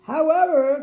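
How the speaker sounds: loud, grand royal "However"; king's announcement. A narrator's reading. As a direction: hold the crowd's attention like a king. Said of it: "continued the king".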